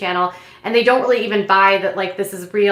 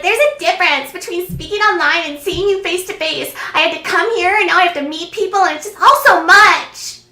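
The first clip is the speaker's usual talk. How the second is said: mock overwhelmed complaint; 8 semitones higher than usual. Girlfriend explains meeting face to face, high mock-overwhelmed complaint.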